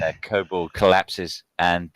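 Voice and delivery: ordinary delivery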